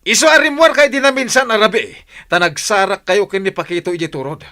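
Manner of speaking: jealous husband shouts at his wife; angry accusation. Acted. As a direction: hammer her with the accusation.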